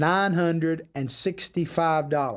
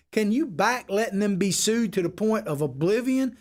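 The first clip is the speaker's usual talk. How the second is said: ordinary talk, nothing marked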